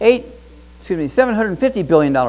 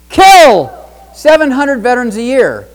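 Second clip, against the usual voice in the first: outraged emphasis; 7 semitones higher, 9 dB louder, slower than usual. Real, not acted. Outraged punchline.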